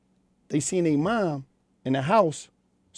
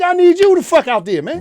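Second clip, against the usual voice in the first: loud outburst; 12 semitones higher, 10 dB louder than usual. Real, not acted. Hammering home his message.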